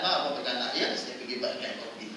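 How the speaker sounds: low, soft, tender request; gentle wife. Retold or imitated. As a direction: coax your husband gently. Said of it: "Cantik tak". (isteri lembut meminta suami pegang anak)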